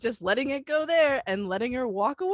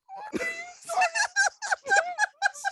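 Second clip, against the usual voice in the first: high screaming laughter; shrieking laugh. Real, not acted. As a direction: howl with laughter. Huge laugh at story.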